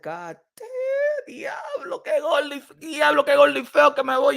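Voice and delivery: high, shouting mock horror at his old videos; shocked at himself. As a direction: cry out in comic disbelief. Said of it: "And I said".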